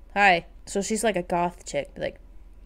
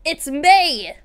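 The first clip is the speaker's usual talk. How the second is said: bright announcement; 5 semitones higher, 5 dB louder than usual. Acted. May answers her friend's greeting.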